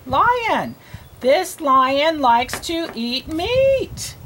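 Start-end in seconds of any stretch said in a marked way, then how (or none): 1.2-4.2 slow, word-by-word reading; spaced-out words, one at a time; slow read-aloud. Shown as a demonstration. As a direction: read slowly for a beginning reader. Models reading for a learner.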